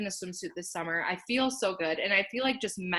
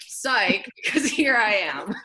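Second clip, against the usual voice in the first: amused laugh in the voice; 4 semitones higher, 3 dB louder, slower than usual. Real, not acted. Joking to the interviewer about her turnaround, laughing. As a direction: chuckle through the punchline.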